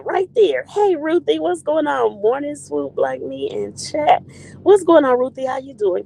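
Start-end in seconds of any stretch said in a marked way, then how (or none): none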